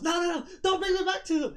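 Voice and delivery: high, loud comic panic; panicked pleading. Acted. Shield begs not to go back to its owner, high and panicked. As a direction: beg at the top of a high voice.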